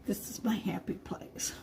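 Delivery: soft breathy whisper, no voice. Real, not acted. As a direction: whisper it like a secret delight. Painting, whispers her joy.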